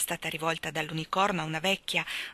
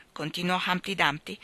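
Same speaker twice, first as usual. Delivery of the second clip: ordinary delivery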